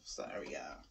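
breathy whisper of sorry, no voice. Real, not acted. Whispering an apology to viewers while testing the spray. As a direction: hush it like you're sorry up close. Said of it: "I'm saying sorry to y'all like".

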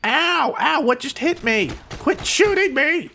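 high-pitched whining protest; indignant yelp. Pretend. Gamer shot by teammate, indignant yelp. Hit by own side.

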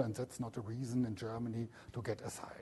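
quick whispered aside; quiet aside. Real, not acted. Lecturer whispering an aside to the class, quick and hushed.

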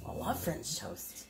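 soft, breathy pretend dog voice; whispered dog voice. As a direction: whisper it like the dog begging for toast. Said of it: "He said".

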